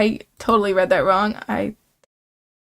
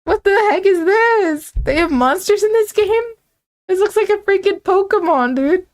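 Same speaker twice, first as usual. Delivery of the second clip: much higher, louder, slowed shocked exclaiming; startled disbelief; 9 semitones higher, 3 dB louder than usual. Real, not acted. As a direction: gasp at the monster out loud. Surprised by monster appearing.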